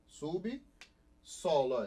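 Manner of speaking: "Subsolo" very slow, split as sub, pause, solo; "Subsolo" pulled apart to show the syllable division; separated syllables. Shown as a demonstration. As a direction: break "Subsolo" after sub with a clear gap. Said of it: "Em sílabas diferentes".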